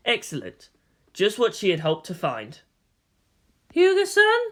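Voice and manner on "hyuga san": much higher, louder rising female voice; questioning woman. A narrator's reading. Kodama checks she has found Hyuga, higher questioning woman. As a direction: ask his name in a woman's voice.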